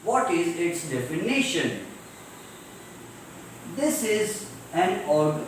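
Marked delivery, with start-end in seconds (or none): 0.9-1.9 "definition" with a raised, stretched NI; stressed syllable before -tion. Shown as a demonstration. Teacher repeating the correct pronunciation, stress on NI in "definition". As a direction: lean on the NI of "definition". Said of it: "be pronounced loudly".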